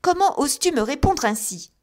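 loud, high outraged rebuke; mother rebukes her daughter for answering back, loud and outraged; indignant mother. A narrator's reading. Mother outraged at her daughter's reply, loud rebuke. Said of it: "reprit Missis Pocket".

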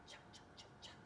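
very soft whispered chugs; nervous little train chuffing under the breath; train chugging. Acted. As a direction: imitate a train with quiet, voiceless puffs. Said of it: "He's making all sorts of train sounds".

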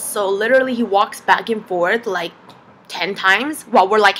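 ordinary talk, nothing marked

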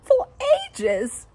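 very high, loud, giddy voice; excited laugh. A narrator's reading. Wife thrilled about tea, high and giddy. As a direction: gush with delight. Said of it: "she laughed".

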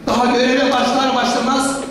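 normal talking voice

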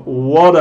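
"what" run into a weak are, like "wudda"; "what" with a schwa tacked on; reduced "what are". Shown as a demonstration. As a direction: say "what" as casual "whadda", punched for learners. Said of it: "vas a escuchar esos".

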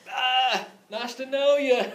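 high-pitched comic goodbye breaking into a laugh; mock dismissive farewell. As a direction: bail out with a jokey, high farewell. Acts fleeing from trapped friend.

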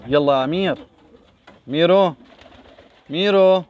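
loud playful calling of a name. Real, not acted. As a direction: call the little one affectionately.

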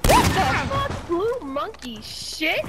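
high, slow, disbelieving cry; shocked outburst. Real, not acted. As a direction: drag it out in shock.

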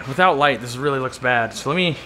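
usual speaking voice

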